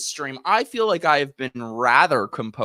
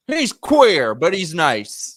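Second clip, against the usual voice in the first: mock grandpa; 9 dB louder, much slower than usual. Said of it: "He's like".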